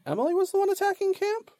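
high, fast disbelieving question; can't believe what she hears; incredulous. Real, not acted. GM shocked by claim.

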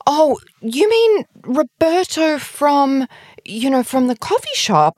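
high, slow, puzzled helpful voice; helpful guessing friend. Retold or imitated. A new acquaintance guessing helpfully, in a high slow voice. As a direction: reach for a guess to rescue her. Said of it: "She's like".

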